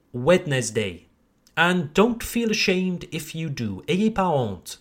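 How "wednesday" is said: Wednesday in three syllables, every letter voiced; mispronounced. Shown as a demonstration. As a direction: say Wednesday as "wed-nes-day". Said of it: "many of you might say".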